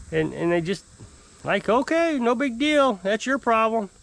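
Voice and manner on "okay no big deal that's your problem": high-pitched, breezy imitation of careless customers; imitating someone who couldn't care less; mock dismissive. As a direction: shrug it off in a mocking high voice. Mimicking customers who brush him off.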